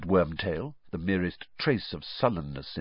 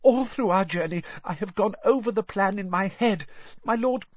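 high, louder anxious rush; gabbling out his fears in a panic; high, frantic servant pleading; panicked servant; 7 dB louder than usual. Acted. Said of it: "panic in his voice now".

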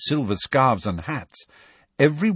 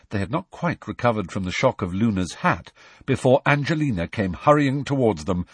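ordinary delivery

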